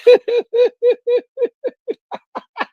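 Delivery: long high-pitched cackle; helpless laughing fit, high and long; long squealing laugh. Real, not acted. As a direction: let a long shrieking laugh roll. Streamer cracking up at chat.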